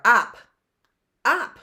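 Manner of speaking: "Op" said high and loud as one unit; teacher showing an easy blend; blended sound. Shown as a demonstration. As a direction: pop out the blend. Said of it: "easy to blend together".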